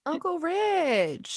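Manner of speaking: high, slow sing-out of a name; drawn-out greeting. Real, not acted. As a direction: stretch the name out high, falling at the end. Calling to an arriving guest.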